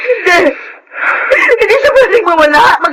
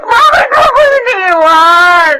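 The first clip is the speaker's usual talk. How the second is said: wailing grief. Acted. Mother asks God why he took her child, sobbing.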